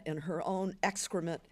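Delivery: usual speaking voice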